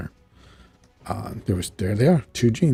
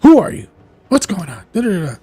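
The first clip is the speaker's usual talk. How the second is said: high, loud startled questions; raised-pitch imitated panic; alarmed demand; 9 semitones higher, 7 dB louder, faster than usual. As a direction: demand answers in acted alarm. Jean alarmed by the strange voice.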